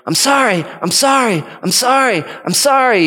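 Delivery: imitated pleading girl. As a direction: call out repeated apologies in a high child's voice. Imitating a sister's repeated apology.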